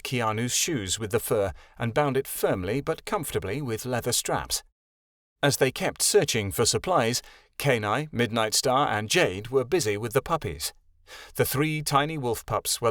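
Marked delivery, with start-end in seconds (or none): none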